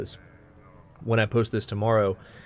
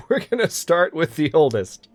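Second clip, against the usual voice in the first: laughing; 6 semitones higher, 4 dB louder than usual. Real, not acted. Gleeful plan to kill heir.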